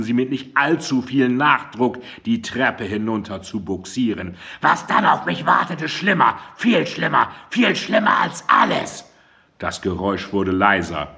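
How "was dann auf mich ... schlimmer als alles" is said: much higher, strained yell; shrill shouting. A narrator's reading. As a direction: cry out in frenzied dread. Mandy shouting on the stairs.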